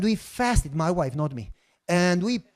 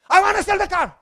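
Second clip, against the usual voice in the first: higher, louder insistence; stubborn outburst; 10 semitones higher, 6 dB louder than usual. Retold or imitated. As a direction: blurt it out like a stubborn child. His stubborn reply to wife.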